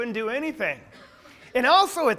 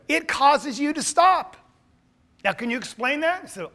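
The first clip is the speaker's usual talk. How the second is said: normal talking voice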